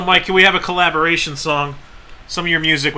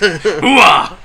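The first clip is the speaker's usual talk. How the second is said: loud gruff Pacino yell; Al Pacino impression; 5 dB louder than usual. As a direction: bark it like Scent of a Woman. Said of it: "Is that Al Pacino?".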